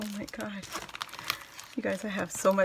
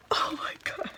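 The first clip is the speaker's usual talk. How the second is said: awed whisper; 6 semitones higher, 4 dB quieter than usual. Real, not acted. Looking at the butterflies, whispering in awe. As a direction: whisper in wonder.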